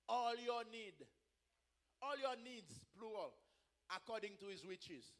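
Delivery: soft, measured verse reading; quiet preacher reciting a verse; hushed scripture quoting. Real, not acted. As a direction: let each phrase settle softly. Quotes scripture in English.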